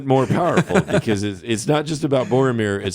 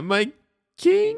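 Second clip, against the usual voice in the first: a pitched-up weak cry; squeaky high voice. Pretend. Mock dying squeak line.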